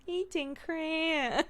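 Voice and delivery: put-on high, slow toddler voice; a hint of a little kid; imitating toddler. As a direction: mimic a toddler's slow reply. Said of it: "she just went".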